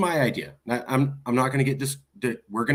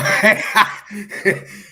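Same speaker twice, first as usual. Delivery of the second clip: a loud burst of real laughter, pitched up; 7 semitones higher, 6 dB louder than usual. Real, not acted. Reaction to the joke.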